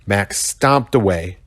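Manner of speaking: ordinary delivery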